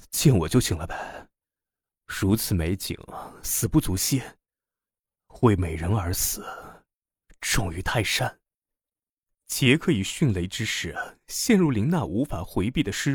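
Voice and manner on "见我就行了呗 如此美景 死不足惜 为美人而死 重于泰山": lightly voiced lower-pitched lover; suave seducer. A narrator's reading. Jack flattering distressed Lina, a hint of suave seducer. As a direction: answer her lightly, with a low flourish.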